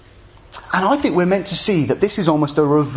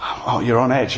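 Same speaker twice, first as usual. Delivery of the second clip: usual speaking voice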